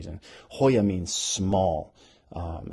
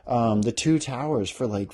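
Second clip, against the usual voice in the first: ordinary delivery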